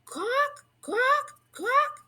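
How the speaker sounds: high rising quacks like a rain bird; bird quacking. A narrator's reading. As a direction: squawk it out like the bird, high and sharp. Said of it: "let out a real ear-bursting rain cry".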